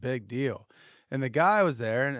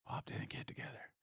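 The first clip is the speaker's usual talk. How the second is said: whispered, much softer punchline; whispered aside; 19 dB quieter than usual. Real, not acted. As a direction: drop it like a secret. Deadpan aside about Bob.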